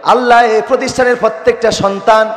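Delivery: high, loud chanted supplication; fervent prayer. Real, not acted. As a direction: call out to Allah before the congregation.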